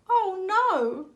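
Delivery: very high tearful "oh no"; sniffling. A narrator's reading. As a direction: sniff it out, left alone. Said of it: "sniffed the ugly duckling".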